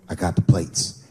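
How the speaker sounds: matter-of-fact tip-off; lightly voiced sister; calm sister.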